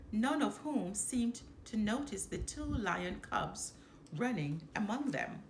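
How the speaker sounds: ordinary delivery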